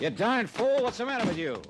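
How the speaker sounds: startled driver shouting in fury; angry shout. Acted. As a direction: yell it at the man who just cut you off. Cut off, nearly crashed.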